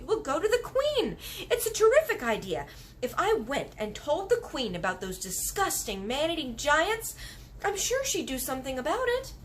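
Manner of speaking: rushing with a new plan; excited girl. Acted. Girl pitching her plan to the giant, eager.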